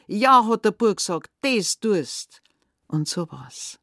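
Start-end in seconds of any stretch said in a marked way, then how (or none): 0.1-2.4 raised, loud agreement; emphatic retort. Acted. Boy answers the screaming stepmother, emphatic retort. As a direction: throw it straight back, loudly.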